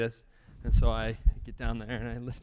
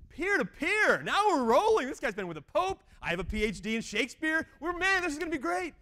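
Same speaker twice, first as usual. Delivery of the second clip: mock excitement; 4 dB louder than usual. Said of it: "Oh, yes".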